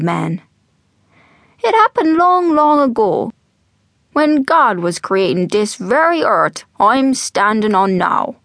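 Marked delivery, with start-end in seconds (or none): 1.6-8.4 put-on animated old man's brogue, pitch swooping; old Irish storyteller. Old man to eager young Jack, storytelling brogue. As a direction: spin the yarn slowly, savouring it.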